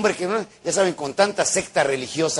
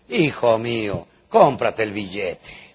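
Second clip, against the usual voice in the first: lower, slow voice from above; voice of God; 7 semitones lower, slower than usual. Retold or imitated. God answering the praying man, slow and low. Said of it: "Escuchó una voz".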